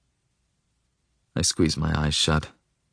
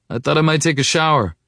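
higher, raised call held back from shouting; half-shouted; 8 semitones higher than usual. A narrator's reading. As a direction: call out without quite shouting. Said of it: "I try to say without shouting".

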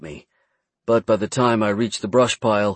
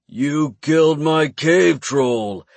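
slow, higher, guttural scream; Jabba the Hutt voice; 5 semitones higher, much slower than usual. Acted. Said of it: "screamed in a voice like Jabba the Hutt".

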